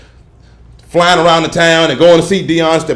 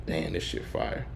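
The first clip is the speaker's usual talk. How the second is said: breathy near-whisper of awe; quiet retold amazement; hushed quote; 10 semitones lower, 19 dB quieter, slower than usual. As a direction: mutter it under your breath, impressed. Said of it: "I'm like".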